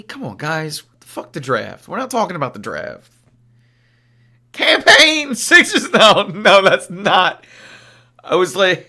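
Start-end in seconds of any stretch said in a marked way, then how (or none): 4.5-7.5 high, loud laughing disbelief; laughing outburst. Real, not acted. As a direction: burst out laughing at chat. Reacting to a chat message.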